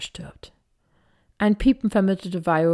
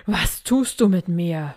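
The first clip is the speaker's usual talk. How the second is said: panicked cry. A narrator's reading. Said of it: "schrie er".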